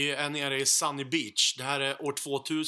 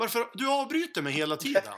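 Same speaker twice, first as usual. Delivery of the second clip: mock complaint; 7 semitones higher than usual. Real, not acted. Storyteller scolding the friend who keeps interrupting, mock annoyed.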